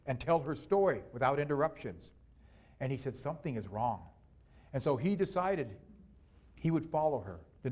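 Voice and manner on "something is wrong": low, hushed conclusion; suspicious aside. A narrator's reading. Husband suspects his wife is changing, low aside. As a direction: voice drops as suspicion settles in.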